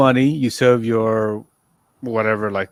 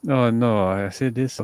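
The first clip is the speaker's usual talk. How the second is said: ordinary talk, nothing marked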